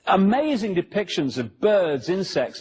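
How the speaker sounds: ordinary talk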